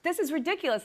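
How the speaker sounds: high-pitched mock exasperation; retold scolding. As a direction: scold him in comic disbelief.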